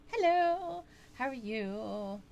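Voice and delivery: high sing-song greeting. Acted. Greeting a viewer brightly.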